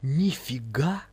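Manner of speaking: slow, drawn-out delighted wonder; joyful amazement. A narrator's reading. Лиза marvels at the gold, joyful astonishment. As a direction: stretch it out, gleefully astonished. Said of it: "воскликнула она с радостью".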